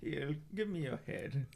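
very low, quiet sinister tone; calm low menace; deep menacing voice. Acted. Carmichael finishes victim.

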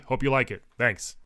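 ordinary delivery

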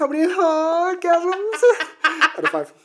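giggling through the question; laughing. Real, not acted. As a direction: laugh as you ask it. Joking rating question while laughing.

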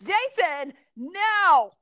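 sharp, high, loud mock scolding; exasperated no. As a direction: bark a stern no at the kid. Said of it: "And I was like".

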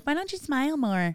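high mocking question; mocking pushy customer. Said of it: "no one would be like".

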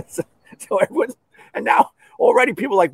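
ordinary delivery

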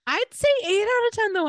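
high, fast, loud upbeat verdict; sudden high excited lift; bright rating. Real, not acted. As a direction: chirp the score up high and fast.

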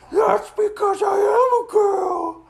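high, loud, drawn-out dragon voice; girlish dragon. Acted. Dragon challenges the intruders, high drawn-out girlish boom. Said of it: "You sound like a girl".

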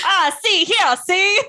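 high mock old-time sportscaster; nineteen-twenties sportscaster. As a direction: do a high-pitched vintage announcer. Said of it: "one of those guys. He's like".

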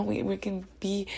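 ordinary delivery

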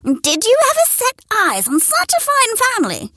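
high, proud hen voice; high proud hen demanding. A narrator's reading. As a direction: show off the chicks. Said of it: "she demanded".

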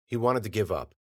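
plain reading voice

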